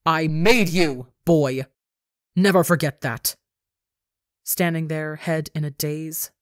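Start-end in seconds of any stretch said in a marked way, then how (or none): 0.0-1.1 loud, slow, drawn-out snap; angry snap. A narrator's reading. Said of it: "as she snaps". 1.2-3.4 loud, commanding snap; angry snap. A narrator's reading. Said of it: "as she snaps".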